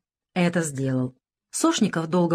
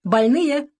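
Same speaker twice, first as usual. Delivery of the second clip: called out higher and louder; louder, higher call to the ward; cheerful call; 8 semitones higher, 4 dB louder than usual. A narrator's reading. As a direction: nurse calling brightly into the ward. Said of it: "вся сияющая Лена".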